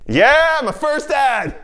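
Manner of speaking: high, loud whooping shout; mock-excited cheer. Cheering his first ad on screen, mock-excited shout.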